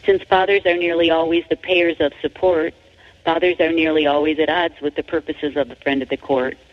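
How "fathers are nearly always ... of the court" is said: normal talking voice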